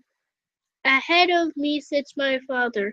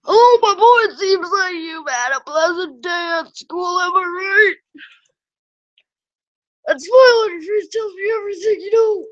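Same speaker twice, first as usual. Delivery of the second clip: father's voice; 5 semitones higher than usual. Acted. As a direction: greet him as the jovial father. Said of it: "I got it".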